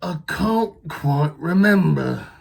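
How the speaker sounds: loud, slow, pondering monster voice; Gruffalo dad musing. Acted. Dad Gruffalo searching his memory, slow and loud. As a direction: stretch it out while thinking.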